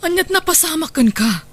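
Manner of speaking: high, alarmed questions; shocked concern. Acted. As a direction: gasp at how thin she looks. Shocked at Corazon's state. (nakigtot nga panangdamag)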